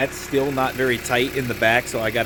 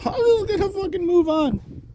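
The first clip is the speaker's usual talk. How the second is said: exasperated laugh. Real, not acted. Gives up on bolt.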